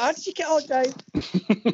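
high-pitched wife asking eagerly after news; imagined wife. Pretend. Wife greets husband home from job interview, high put-on voice. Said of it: "he gets home and she's like".

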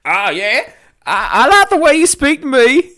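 Randy McMatheson voice. Acted. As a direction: pitch way up, leering. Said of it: "as Randy McMatheson".

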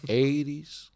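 softly imitated Mexican-accented driver; retold cabbie with a Mexican accent. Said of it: "the quintessential Mexican accent".

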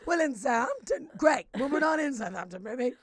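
loud, combative comeback; mock aggressive. Real, not acted. Correcting co-host about Southampton, aggressive comeback. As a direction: snap back with over-the-top attitude. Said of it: "I was that aggressive about that".

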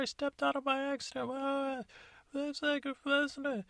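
high squeaky voices trading insults; high-pitched comic squabble; squeaky bickering voice. Acted. Fairy godmother and stepmother arguing.

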